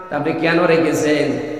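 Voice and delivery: ordinary talk, nothing marked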